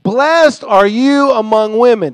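high, loud, slow proclamation; loud exclamation. Acted. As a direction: exclaim the blessing at full voice. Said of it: "In a loud voice, she exclaimed".

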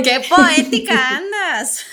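teasing through laughter; laughing. Real, not acted. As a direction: laugh while teasing her.

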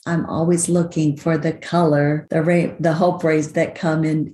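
ordinary talk

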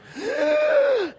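very high, sustained screaming gasp; imitated terrified scream. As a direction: mimic a baby's shriek of fright. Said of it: "he screamed and freaked out".

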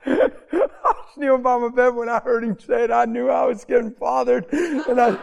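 high, loud, laughter breaking through; laughing while talking. Real, not acted. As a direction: push the words out through real laughter. Tells story through own laughter.

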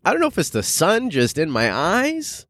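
normal talking voice

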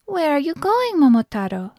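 high-pitched mother, dropping on the name; high old-woman voice, wondering; questioning mother. A narrator's reading. Mother questions her son.

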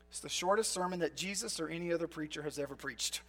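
ordinary talk

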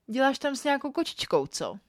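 a hint of police interrogation; policewoman's interrogation. A narrator's reading. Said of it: "mluvila jako nějaká policajtka".

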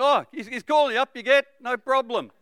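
high, loud fake-friendly crowd voice; mock the mob's sudden friendliness; fickle mob. The mob suddenly encouraging Bartimaeus, high and loud. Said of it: "Next minute".

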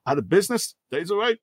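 high rambling retelling; rambling mock voice. Retelling Lewis's rambling monologue, high babble. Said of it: "in my heart, it's all like".

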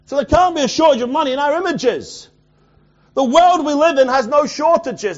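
high, loud preacher in full flow; impassioned preaching. Real, not acted. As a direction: drive it home to the congregation, loud and high.

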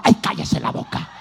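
put-on a breathless, hushed old lady telling him to shut up; mock the frightened lady hushing the pastor; hushed scolding lady. Scared lady on the plane hushes the pastor, breathless.

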